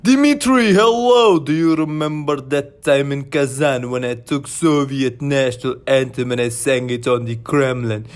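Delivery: a hearty Russian accent boasting to Dimitri. Shown as a demonstration.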